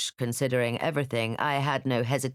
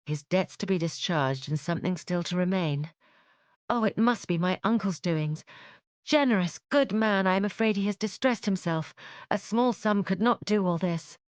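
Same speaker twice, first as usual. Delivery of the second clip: higher, touched and worried voice; moved realisation; 5 semitones higher than usual. Acted. Elizabeth grasping her uncle's sacrifice, moved and anxious. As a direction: realise his generosity with concern.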